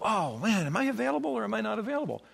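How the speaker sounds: hammed-up indecision, quickening at the end; mock agonizing. Pastor pretending to agonize over a coffee invitation, mock dithering. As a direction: act torn over a simple choice, rising in pitch.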